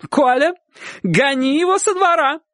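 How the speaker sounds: high, urgent command; shouted order. A narrator's reading. Aunt to Uncle Kolya against dog-catcher, shouting. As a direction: call out the order, pitched very high. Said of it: "крикнула тётушка".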